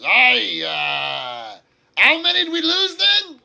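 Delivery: high-pitched, shouted dwarf voice; loud dwarf. Acted. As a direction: holler it out as the dwarf. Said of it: "and the dwarf says".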